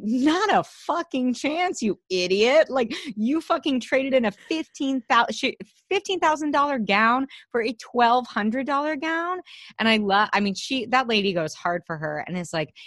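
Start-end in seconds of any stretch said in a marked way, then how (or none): 0.0-9.4 mock outrage. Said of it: "the lady is like".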